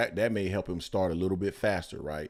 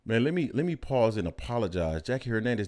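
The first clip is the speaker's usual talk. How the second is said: usual speaking voice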